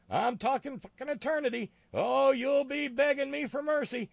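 high furious ranting yell; sputtering foiled villain. Acted. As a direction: shriek curses at the crowd. Voicing cartoon Jesus raging at Pharisees.

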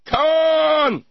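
huge high yelled name; Khan scream. Pretend. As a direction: bellow the name like Kirk. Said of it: "first Star Trek reference".

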